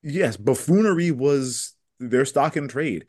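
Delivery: ordinary talk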